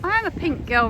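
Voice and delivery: ordinary talk, nothing marked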